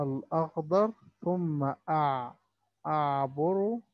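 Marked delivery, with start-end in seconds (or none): 1.2-3.8 اعبر مع نطق العين واضح من الحلق; clear ain. Shown as a demonstration. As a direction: stretch أعبر slowly with a clear ain. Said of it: "بعض الكلمات اللي فيها حرف العين". (نطق العين في أعبر بوضوح)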